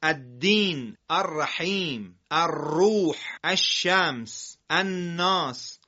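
الدین, الرحیم, الروح, الشمس, الناس read slowly with the lam silent; deliberate drawn-out recitation; slow model reading. Shown as a demonstration. Teacher models words for listeners to repeat. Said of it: "کلماتی مثل".

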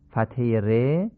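ordinary delivery

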